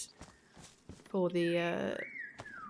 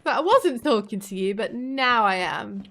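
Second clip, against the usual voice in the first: mock loud retort; 8 dB louder than usual. Husband jokingly acting his comeback to his wife, loud and cheeky. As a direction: snap back at her, then drag out the punchline.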